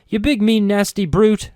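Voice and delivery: high, loud, half-hearted scolding; mock insult. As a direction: play mean but can't quite. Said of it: "I suppose I could try".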